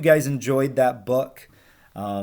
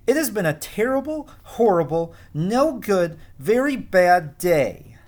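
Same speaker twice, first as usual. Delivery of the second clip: whiny refrain; 6 semitones higher, 3 dB louder than usual. Acted. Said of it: "repeated refrain, higher and drawn out".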